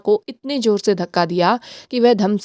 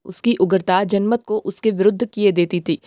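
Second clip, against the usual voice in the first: usual speaking voice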